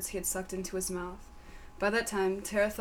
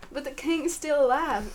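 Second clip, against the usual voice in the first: lady voice; 7 semitones higher, 6 dB louder than usual. Acted. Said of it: "said Sally Minefield".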